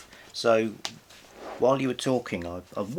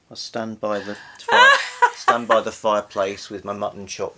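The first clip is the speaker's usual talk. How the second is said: louder, laughing through a playful pose as a stuffy Victorian man; laughing mock patriarch; 7 dB louder than usual. Host laughing, casting herself as the whiskered patriarch. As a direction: giggle while playing the pompous husband.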